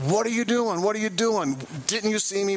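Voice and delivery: confronting the driver after she pulls over, angry; retold angry yelling. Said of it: "I'm yelling".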